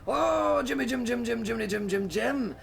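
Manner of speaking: a deliberately bad Scottish Billy Connolly. Shown as a demonstration. DM shows his bad Scottish impression to viewers. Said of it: "I do a really bad Billy Connolly impression".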